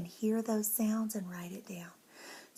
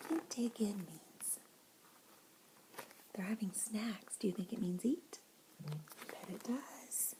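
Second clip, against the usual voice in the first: whisper; 11 dB quieter than usual. Real, not acted. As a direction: lean in and whisper the question. Whispered questions to children.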